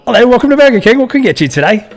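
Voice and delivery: loud, high-pitched fake Aussie; hammed-up Australian accent, high and loud; really bad Australian accent. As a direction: belt out a terrible Australian drive-thru greeting. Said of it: "do a really bad Australian accent".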